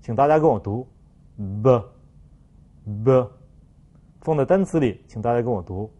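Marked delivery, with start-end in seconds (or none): 1.6-3.3 b as a heavy French voiced stop; voiced consonant. Shown as a demonstration. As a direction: press lips shut, then release a voiced, murky b. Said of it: "用声带震动，用喉咙来发音".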